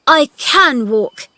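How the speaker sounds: high, loud, slow protest; shouting. A narrator's reading. Boy denying he is crippled, shouting. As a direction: fling it back defiantly. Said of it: "he shouted".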